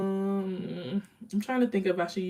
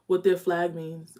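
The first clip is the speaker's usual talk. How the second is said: normal talking voice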